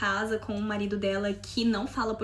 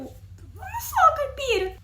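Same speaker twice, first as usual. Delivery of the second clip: high, drawn-out laughing whine; mock-whiny protest; 13 semitones higher, slower than usual. Learner answering the friend's teasing, playful whine. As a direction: stretch it out as a playful wounded protest.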